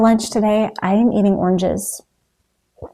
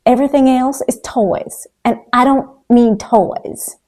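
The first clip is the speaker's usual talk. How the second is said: put-on Southern accent; 4 semitones higher, 5 dB louder than usual. Vlogger quoting her mentor's motto in a mild Southern accent. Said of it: "in her delightful Southern accent".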